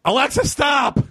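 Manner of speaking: high shouted Alexa, stop; retold shout. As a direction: yell it across the house at the device. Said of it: "I just scream at the top of my lungs".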